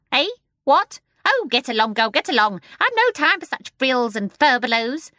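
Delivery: very high, loud, fast bird voice; a scolding, chattering bird; shrill starling. A narrator's reading. The starling scolding the babies from the window.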